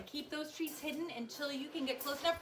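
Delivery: ordinary delivery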